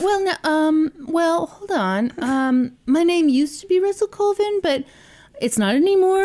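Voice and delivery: high, hesitant dodging; evasive dope voice. Retold or imitated. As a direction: stall like a caught liar.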